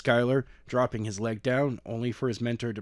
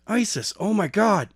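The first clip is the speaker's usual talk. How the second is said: with tears forming; 8 semitones higher, 3 dB louder than usual. A narrator's reading. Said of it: "with tears forming in his eyes".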